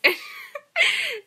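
very high, near-crying laugh; squealing laugh. Real, not acted. Vlogger losing it over the wrong guy, squealing laugh. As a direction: shriek with laughter.

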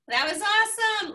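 bright, high and loud praise; excited praise. Real, not acted. Host cheering the storyteller, excited praise. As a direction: gush about the story.

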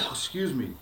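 plain reading voice

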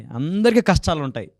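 ordinary delivery

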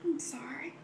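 a broken, whispered sorry through real tears; soft whispered apology, on the edge of crying; tearful whisper. Real, not acted. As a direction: barely whisper it while choking back tears.

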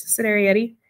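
usual speaking voice

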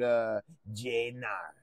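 usual speaking voice

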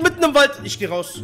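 ordinary delivery

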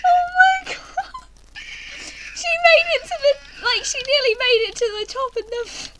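high, loud screaming excitement; excited shriek. Real, not acted. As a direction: scream it out in glee. Friend nearly reaches top.

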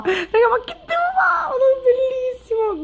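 very high-pitched fangirl squeal; overwhelmed. Real, not acted. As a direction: squeal helplessly over something gorgeous. Overwhelmed by the card's beauty.

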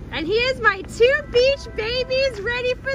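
high, loud sing-song hype. Real, not acted. Mom showing her two beach babies and asking if they're excited, high hype.

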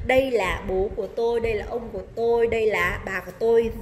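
normal talking voice

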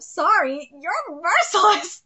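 ordinary delivery

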